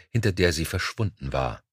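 ordinary delivery

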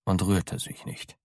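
usual speaking voice

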